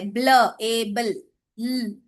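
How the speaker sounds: ब्ल, एबल and ल sounded out slowly; sounding out the blend. Shown as a demonstration. Teacher to trainees, slowly sounding out the blend. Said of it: "ये एक ही consonant blend है".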